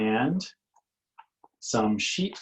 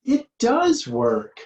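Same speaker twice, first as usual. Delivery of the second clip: high, loud and slow; delighted; 6 dB louder, slower than usual. Acted. Cat finding her song works after all, delighted. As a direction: savour the joy of it.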